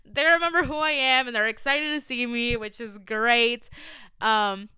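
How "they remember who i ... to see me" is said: high, loud "oh shit" of sudden surprise; stunned surprise. Retold or imitated. Reacting to fans who remember her, surprised. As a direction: caught off guard, a bit louder and higher. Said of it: "and I'm like".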